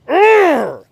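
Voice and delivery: loud, high roaring grunt; fired-up growl. Retold or imitated. Athlete reacting to a hard hit, fired-up growl. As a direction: let out a pumped-up "urgh". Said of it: "I just got fired up".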